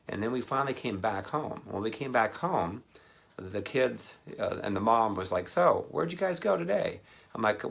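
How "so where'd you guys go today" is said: mom voice. Retold or imitated.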